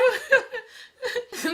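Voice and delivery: loud, high cackle of laughter; helpless high laughter between lines. Acted. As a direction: let the laugh burst out. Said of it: "mais arrête de rigoler".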